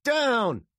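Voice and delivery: loud, high one-word command; barked order. A narrator's reading. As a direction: order a captive to the ground. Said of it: "ordered Mills".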